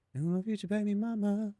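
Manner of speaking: a quick goofy character aside; mock put-on voice. Mock voice about future kid.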